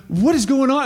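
high, loud alarmed question; startled fear; terrified Philistines. Retold or imitated. Philistines hearing the camp shake, alarmed. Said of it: "They're like".

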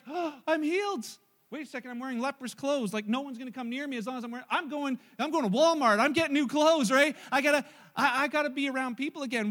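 a healed man rushing off to buy clothes; excited rush. Retold or imitated. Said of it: "One of them's like".

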